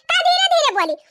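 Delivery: high mocking throw-back of "धीरे धीरे बोली"; indignant echo. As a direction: repeat her words back, outraged and high. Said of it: "ऊपर से कहा यहाँ धीरे धीरे बोली".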